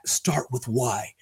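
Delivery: hushed, slow whisper; serious Simon Sinek. Retold or imitated. Imitating a serious speaker's catchphrase, in a slow whisper. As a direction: whisper it slowly, as a serious thought-leader slogan. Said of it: "a little more serious".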